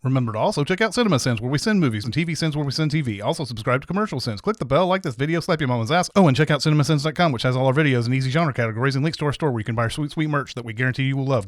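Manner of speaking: fast, loud ad-read patter, rattling off the plugs; rushed outro plug. Acted. Narrator rushing the channel plugs to viewers, fast ad read. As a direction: hurry through it like a disclaimer at the end of an ad.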